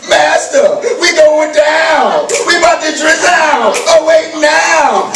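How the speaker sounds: high, slow, shouted chant of panic; panicked disciples. Acted. Preacher replays the disciples' rhyme, shouted in panic. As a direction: holler it like the boat is sinking. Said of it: "He told the wind".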